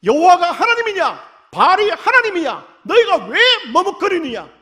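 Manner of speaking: high, loud prophet's shout challenging the people; Elijah crying out. Retold or imitated. Elijah shouts to the people at Carmel, a loud high challenge. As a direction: call out to the wavering crowd, loud and high. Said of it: "엘리야가 외쳤죠".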